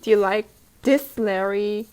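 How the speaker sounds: ordinary delivery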